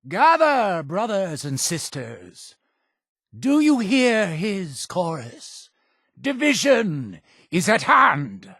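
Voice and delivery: high-pitched rallying cry to a congregation; zealous preacher's summons; calling the faithful. Acted. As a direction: summon the flock, voice lifted high. Summons congregation to hear divine chorus.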